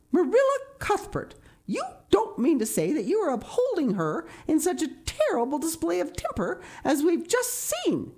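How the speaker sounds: very high-pitched, scandalized demand; indignant. A narrator's reading. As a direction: demand it in outraged disbelief. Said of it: "demanded Missus Rachel indignantly".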